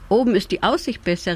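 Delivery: ordinary delivery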